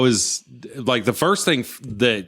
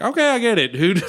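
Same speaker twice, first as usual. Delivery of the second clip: much higher, whiny okay-fine; exasperated giving in; 9 semitones higher than usual. Retold or imitated. Fan giving in to the camera guy, exasperated. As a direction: back down with mock annoyance. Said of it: "I was like".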